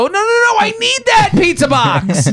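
a hammed-up hoarder begging to keep a pizza box; frantic hoarder. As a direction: squeal it like a hoarder clinging to his junk. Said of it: "they go".

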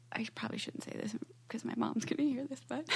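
hushed secret whisper. Real, not acted. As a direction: whisper so mom won't hear. Secret her mom shouldn't hear.

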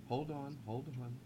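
very soft, low plea to wait; barely audible, choked interruption; hushed and overcome. Pretend. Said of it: "choked up".

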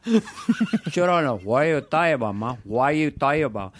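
put-on Tony Montana Cuban accent; brash Cuban gangster voice; Scarface Cuban accent. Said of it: "What if Scarface met Robert Shaw".

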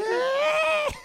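wailing falsetto whine; a hammed-up high cry, mock-weeping; silly high wail. Co-host answers in a mock crying falsetto.

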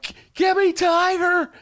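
high, loud, slow hammed-up whine; whiny plea. After grim headlines, begging for Tiger King, whining. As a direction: demand it like an addict. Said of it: "I'm like".

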